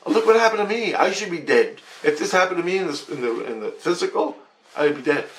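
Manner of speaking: high-pitched, incredulous protest; exasperated disbelief. Retold or imitated. Dreamer arguing with Jesus about the dead dog, high and incredulous. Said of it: "And I'm like".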